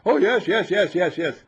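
high, loud mock-eager yeses with a laugh. Teacher mocking students' eager yes, high and loud. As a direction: parody their eager agreement. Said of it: "Are you ready for such a thing?".

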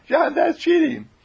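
high, loud squealed accusation; piping indignant scold; scolding Marion. A narrator's reading. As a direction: squeal it in protest.

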